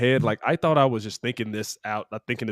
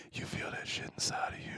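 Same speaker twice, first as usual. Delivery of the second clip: very soft whisper, right into his ear; menacing whisper; 18 dB quieter than usual. Acted. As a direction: whisper it like a killer savoring the moment. Acting out murderer breathing in victim's ear.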